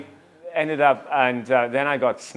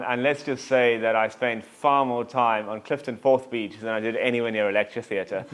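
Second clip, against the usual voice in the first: ordinary talk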